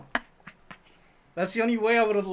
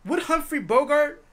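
slow, higher pompous musing; mock Vin Diesel; 6 semitones higher, 3 dB louder, slower than usual. Riffing on Vin Diesel, a slow, pompous mock question. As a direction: ham up the self-serious actor. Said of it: "mocking Vin Diesel continued".

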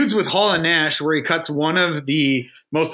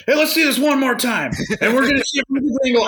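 Tony Schiavone; 9 semitones higher, faster than usual. Retold or imitated. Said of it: "Tony Schiavone's like".

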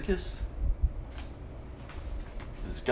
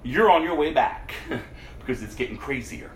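amused alarm. Retold or imitated. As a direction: laugh while you say it. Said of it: "you go".

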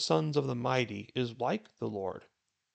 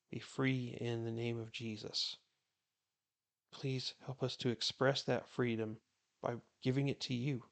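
softer, hushed prayer voice; quiet prayer; 5 dB quieter than usual. Real, not acted. Pastor prays before the Bible reading, softly and earnestly. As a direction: pray gently and earnestly to the Lord.

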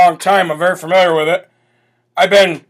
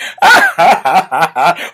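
imitating a cackle, hammed-up; a teasing put-on laugh; mock cackle; 3 semitones lower than usual. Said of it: "cackling Kamala Harris".

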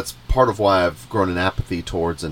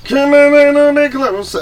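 high, slow put-on voice; mock coaxing; 8 dB louder, slower than usual. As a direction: tease him closer like a pet. Mocking cohost's mic instruction.

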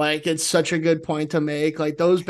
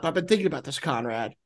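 ordinary talk, nothing marked

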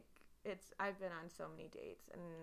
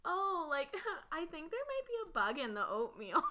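fake casual; 8 semitones higher, 5 dB louder than usual. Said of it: "trying to be, like, a cool girl".

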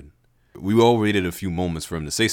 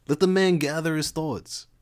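higher laid-back bro; Levi voice; 9 semitones higher than usual. Acted. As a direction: tell them to give him space. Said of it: "that Lev sound".

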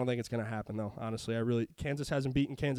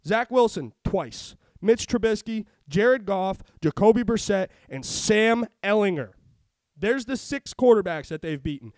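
louder, heated listing of quarterbacks; angry rant; 4 semitones higher, 7 dB louder than usual. Real, not acted. Ranting to his co-host about the Patriots defense, angry and loud. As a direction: rail against the overrated defense. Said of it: "Rant over".

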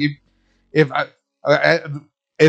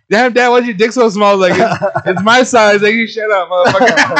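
laughing, higher and louder joking voices; dad joking with his kids, laughing higher and louder; laughing joke; 6 semitones higher, 6 dB louder than usual. Pretend. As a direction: play out the kids teasing dad, cracking up. Imagined kids teasing dad, laughing.

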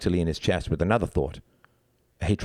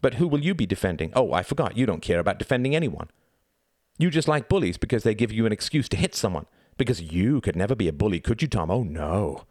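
sarcastic, swooping higher pitch; sneering; 4 semitones higher than usual. Acted. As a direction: taunt him with mock innocence. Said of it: "sneered Reginald".

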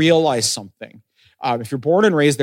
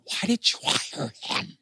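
slow, hushed stage whisper; whispered disbelief; 7 semitones higher, 10 dB quieter, slower than usual. Retold or imitated. Coworkers asking the manager about the new hire, incredulous whisper. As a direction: whisper it slowly, as a pointed aside.

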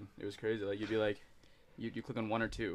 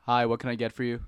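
louder, bright drive-through greeting; cheerful cashier; 9 dB louder than usual. Pretend. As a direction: greet the car with a smile. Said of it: "let's go back to it".

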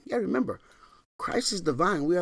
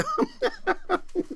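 high laugh. Real, not acted. Preacher laughing at own joke, high giggle.

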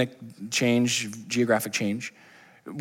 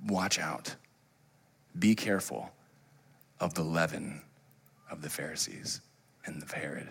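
a low, much softer warning; quiet warning; 7 semitones lower, 13 dB quieter than usual. Retold or imitated. Jesus warning his disciples, low and hushed. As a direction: lean in and warn them gently. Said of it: "He goes".

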